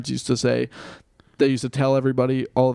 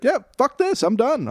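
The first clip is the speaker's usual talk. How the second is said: upset; 9 semitones higher than usual. Retold or imitated.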